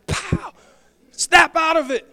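sharp high loud pow, snap out of it; a sudden mock slap, high and loud. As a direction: smack them awake with the voice. Mock slap to wake panicker.

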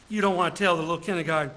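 usual speaking voice

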